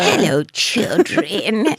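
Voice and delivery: slow, creepy witch greeting; evil witch voice. Pretend. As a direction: drawl it like a wicked witch. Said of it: "Just evil witch voices".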